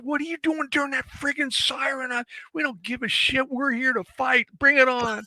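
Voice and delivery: high, pumped-up mock bravado; defiant taunt. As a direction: throw it back at them, cocky. Marines' defiant reply retold.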